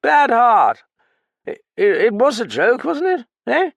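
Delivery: put-on high, loud, slurred drunk; drunken Graffin. Drunk man pleading with narrator, high and slurred. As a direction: play the sodden old pianist rambling.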